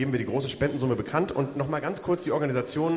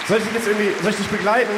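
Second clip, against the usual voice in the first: louder, higher showman tone; grand entrance announcement; 7 semitones higher, 6 dB louder, faster than usual. Acted. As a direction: keep the host voice going. Noch im Showton. (Showmaster-Ton)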